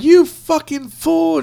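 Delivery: slow high sneer, drawn out; mock angry quote. Quoting a movie lady, slow mocking sneer. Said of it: "she's like".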